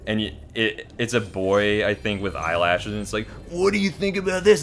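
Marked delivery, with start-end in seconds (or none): 3.5-4.6 high, loud put-on cartoon voice; grown man voicing kid. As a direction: imitate a grown man playing a little boy. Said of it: "that sounds like a grown-ass man".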